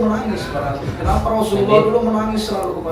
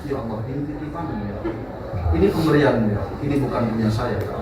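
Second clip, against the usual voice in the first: softer, quicker inner humble whisper of the heart; 5 semitones lower, 5 dB quieter, faster than usual. Retold or imitated. Preacher voices the humble heart's quiet prayer to God. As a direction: say it softly as the heart's own prayer. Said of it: "tapi hati".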